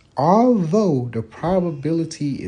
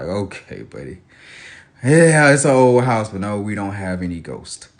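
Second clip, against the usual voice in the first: dismissive dad; 11 semitones lower than usual. Retold or imitated. Husband brushes off wife's ghost theory, low and dry. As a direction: humour her and brush it off.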